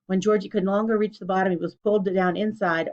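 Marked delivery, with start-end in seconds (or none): none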